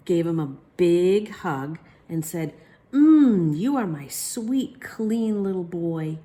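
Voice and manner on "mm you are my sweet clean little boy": tender. Acted. Mother hugging child.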